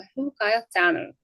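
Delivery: ordinary delivery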